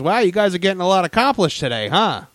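lightly voiced animatronic character; Scuttle. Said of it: "Scuttle turns to us and goes".

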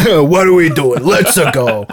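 mock American Mario commercial voice; Mario ad actor. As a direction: sell it to kids like a hammy TV actor. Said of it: "he would always be like".

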